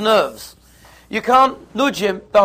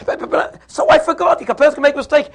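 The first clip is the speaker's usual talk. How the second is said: stammering into a high, loud protest; acted defensive yelling, pitch shooting up; louder and louder; 8 semitones higher, 5 dB louder than usual. Husband defends forgetting the dress, stammering loud protest. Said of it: "it's getting louder and louder".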